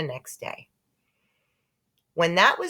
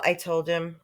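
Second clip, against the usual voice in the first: plain reading voice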